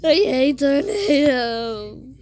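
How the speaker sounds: very slow, drawn-out sobbing; hammed-up scared wail; play-acted frightened crying. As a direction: bawl through the words in mock fear. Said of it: "I know it's scary".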